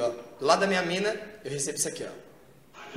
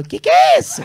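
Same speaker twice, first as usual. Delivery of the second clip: very high, loud squeal of a question; shrieked, high-pitched bafflement; mock startled girl; 8 dB louder than usual. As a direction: squeal it in shock. Girl startled by his strange words.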